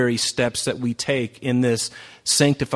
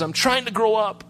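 put-on little boy's voice; 9 semitones higher than usual. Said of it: "He says".

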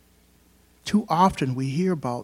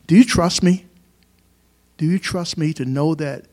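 God's question called out loud and high; loud preaching; 3 semitones higher, 8 dB louder than usual. Acted. Preacher voicing God asking his flock if they trust him, called out loud. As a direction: ring it out like a challenge.

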